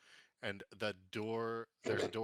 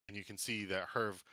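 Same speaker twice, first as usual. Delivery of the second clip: normal talking voice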